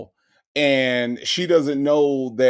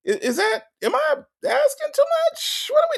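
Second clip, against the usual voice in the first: incredulous; faster than usual. Real, not acted. Host incredulous at caller's logic, squeaky high disbelief. Exasperated rhetorical questions.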